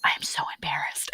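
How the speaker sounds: hushed, breath-only confession; embarrassed whisper. Retold or imitated. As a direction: imitate a mortified mom whispering. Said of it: "my mom was like".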